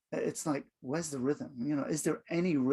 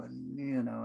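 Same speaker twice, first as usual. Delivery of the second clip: too slow; 4 semitones lower, 7 dB quieter, slower than usual. Shown as a demonstration. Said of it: "do speak like that".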